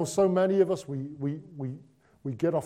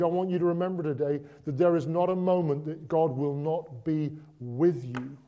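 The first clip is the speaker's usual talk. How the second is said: normal talking voice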